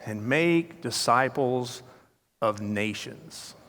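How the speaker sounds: slow, solemn scripture reading; slow, grave reading aloud. Retold or imitated. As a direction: read it out slowly like a verse. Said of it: "he opens it up and says".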